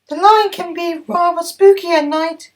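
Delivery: very high, loud bright engine voice; very high cheery diesel voice; Mavis the diesel. Acted. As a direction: pipe up high and brisk. Mavis warns.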